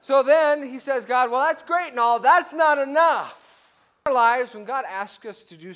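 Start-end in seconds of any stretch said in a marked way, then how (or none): 1.0-3.4 demanding Gideon. Acted. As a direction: refuse to be satisfied, draw it out. Gideon voiced demanding more.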